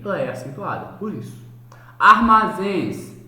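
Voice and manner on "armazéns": "Armazéns" called out loud and slow, zéns strongest; final-syllable stress. Shown as a demonstration. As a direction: draw out "Armazéns", landing on zéns. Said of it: "a oxítona é a mais forte".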